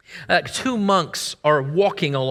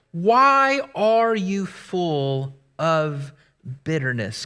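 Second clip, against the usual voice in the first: ordinary talk, nothing marked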